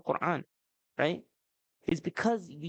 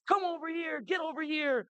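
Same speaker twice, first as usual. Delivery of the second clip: scolding parent's call, voice pitched way up; imitated parental yelling; 12 semitones higher than usual. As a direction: act out a parent hollering for the kids. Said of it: "We yell at them".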